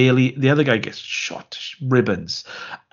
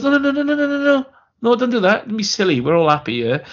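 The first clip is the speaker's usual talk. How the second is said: hurried mock reassurance; 11 semitones higher, faster than usual. Wife dissuading her husband from seeing a doctor, mock reassurance. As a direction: brush it off in a fussy high voice.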